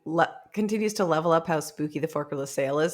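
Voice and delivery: ordinary talk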